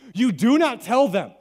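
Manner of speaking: you do NOT tell them, slow and loud; slow, loud, each word hammered; emphatic stress. Real, not acted. Emphatic warning to congregation.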